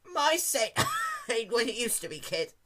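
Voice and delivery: put-on high, creaky coughing old voice; old man. A narrator's reading. Old Pegasus repeating himself to Dash, creaky cough. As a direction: wheeze it through a cough. Elderly pony again.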